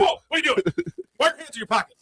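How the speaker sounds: high-pitched, jumpy mock alarm; fidgety trooper. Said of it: "if he was really fidgety".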